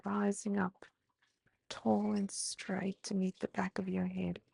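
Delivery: gentle yoga instructor voice; guided breathing cues. Acted. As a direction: talk them calmly through each breath. Leads a guided breathing sequence.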